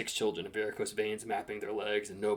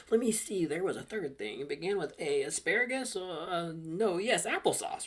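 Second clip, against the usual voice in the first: higher, singsong thinking aloud; muttering shopper; 7 semitones higher, 4 dB louder than usual. A narrator's reading. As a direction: puzzle through a shopping list in a lifted voice. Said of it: "and muttering".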